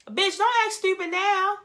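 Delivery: high, loud, slow telling-off; scolding. Real, not acted. Commentator scolding a castmate who plays dumb, loud and high. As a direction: call her out, high and drawn out.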